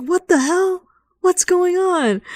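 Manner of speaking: high, alarmed what the hell; startled panic. Retold or imitated. Pilots ambushed by invisible ghosts, high startled panic. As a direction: yelp it as if shot from nowhere.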